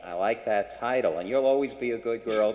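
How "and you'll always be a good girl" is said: ordinary talk, nothing marked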